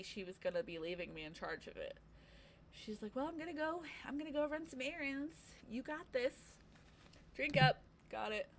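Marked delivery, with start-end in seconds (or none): 3.2-7.8 lightly voiced boss, higher and airy; a hint of a carefree boss voice; breezy boss. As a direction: toss it off like a cheerful boss heading out.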